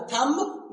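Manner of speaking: थंब with the silent B voiced; wrong pronunciation. Shown as a demonstration. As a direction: stress a clear "b" closing थंब. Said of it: "नहीं बोलना है".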